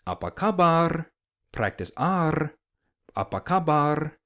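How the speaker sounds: Apa kabar with a trilled final R; rolled r. Shown as a demonstration. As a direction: Apa kabar, rolling the R at the end. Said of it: "we can get into kind of".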